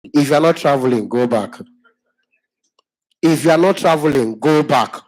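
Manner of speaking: loud barked order to go back; gruff loud dismissal at the gate; rude airport shout. Retold or imitated. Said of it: "just hear one".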